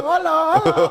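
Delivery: high mock girl's hola; imitating a fan. Copying a Spanish girl greeting him, high squealed hola. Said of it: "She's like".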